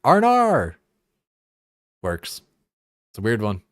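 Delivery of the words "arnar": "Arnar" said high and loud, Aussie style; Australian "oh no". Shown as a demonstration. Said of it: "thing like an Australian".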